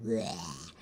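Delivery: put-on old dragon grunt. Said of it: "instead of his, like".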